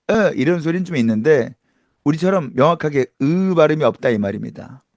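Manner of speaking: normal talking voice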